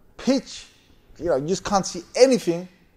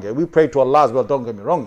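ordinary delivery

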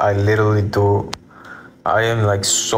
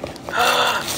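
gasp; 14 semitones higher, 3 dB quieter than usual. Real, not acted. Shocked gasp before comment.

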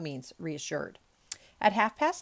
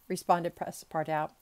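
usual speaking voice

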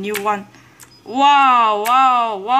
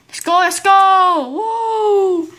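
excited cheering; 7 semitones higher, 4 dB louder, slower than usual. Real, not acted. Gamer celebrating extra life.

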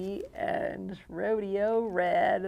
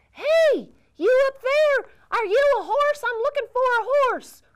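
loud, much higher shout upward; calling up high; 13 semitones higher, 9 dB louder than usual. Acted. Cowboy shouting up to the owl, high and loud. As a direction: yell up to the branches.

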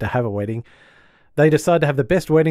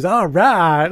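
higher, louder, drawn-out approval; pleased retold exclamation; 5 semitones higher, 5 dB louder than usual. Man spotting the daughter, mock-delighted exclamation. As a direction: play up his delight on seeing her. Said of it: "he just goes".

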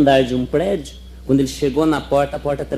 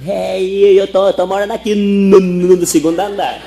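stuttering woman; 5 semitones higher, 5 dB louder than usual. Acted. Personagem gaga na piada.

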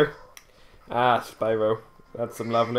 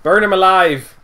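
gleeful shout; 5 semitones higher, 9 dB louder than usual. Pretend. Gleeful chant while playing.

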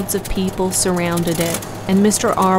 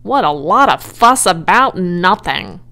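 louder grumbling complaint; muttering rat; 6 dB louder than usual. A narrator's reading. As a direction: grumble with scorn. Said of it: "muttered the rat".

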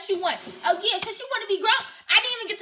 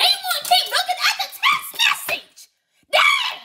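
screaming; 11 semitones higher than usual. Real, not acted. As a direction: yell it out in disbelief. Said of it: "I'm sorry for all the screaming".

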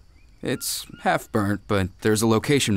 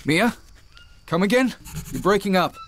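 higher, questioning call over a failing line; urgent calling; 7 semitones higher than usual. Acted. Man calling to Mia as the radio breaks up, urgent. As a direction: raise the pitch to reach a fading signal.

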